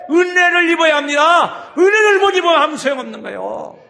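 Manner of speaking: high, loud exhortation; fiery preaching. Real, not acted. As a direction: press the point home from the pulpit. Preacher exhorting at full force. (힘차게 외치는 설교)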